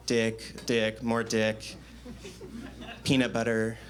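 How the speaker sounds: drawn-out, deliberate, very slow; slow deadpan list. Acted. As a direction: savor each word slowly. Comic list dragged out.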